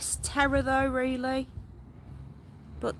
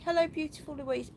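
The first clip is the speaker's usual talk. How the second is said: usual speaking voice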